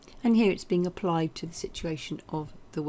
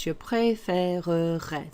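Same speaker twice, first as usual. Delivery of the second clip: slow and careful; slower than usual. Shown as a demonstration. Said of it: "Take your time when you're saying it".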